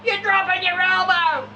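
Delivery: high, loud barked pool-deck yell; mock coach yell. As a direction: bark it like a coach across the pool. Coach yelling at swimmer.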